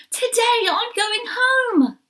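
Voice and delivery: high, slow, thrilled shout; squealing with excitement; excited cry. A narrator's reading. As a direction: burst out with joy like a little bunny. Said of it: "he cried with excitement".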